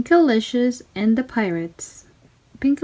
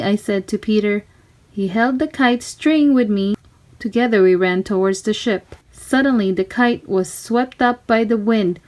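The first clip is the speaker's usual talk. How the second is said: ordinary delivery, nothing marked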